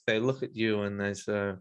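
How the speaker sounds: usual speaking voice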